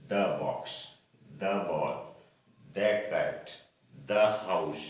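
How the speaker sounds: the before consonants said as "thuh". Shown as a demonstration. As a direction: say the with a short schwa, "thuh box". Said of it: "तो इसको हम पढ़ेंगे the". (the को "द" बोलें)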